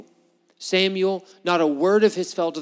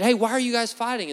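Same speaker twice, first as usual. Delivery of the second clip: higher-pitched challenge to two brawlers; acted confrontation; 7 semitones higher than usual. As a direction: call out to break up a quarrel, lightly acted. Acts Moses confronting two fighters.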